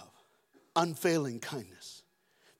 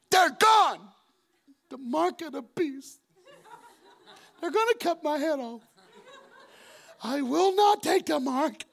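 high mock-terrified wail; hammed-up panic; 11 semitones higher, 4 dB louder than usual. Boy thinks he was left behind, hammed-up panic. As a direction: wail it like a child facing doom. Child convinced he missed the rapture.

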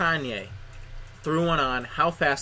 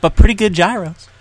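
loud tagline; 4 semitones higher, 10 dB louder than usual. Acted. Playful ad tagline.

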